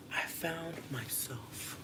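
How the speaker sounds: slow whispered punchline; slow whispered joke to the chat. Real, not acted. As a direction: whisper it smugly. Late night joke.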